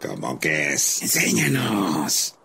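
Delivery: low, slow wolf voice; a hungry wolf pack questioning the fox, low and slow; gruff wolf. Acted. Wolves demand to be shown.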